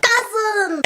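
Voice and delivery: calling out. Pretend. Calling out in Wakanda joke.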